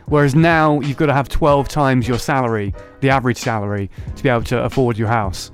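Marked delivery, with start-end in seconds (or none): none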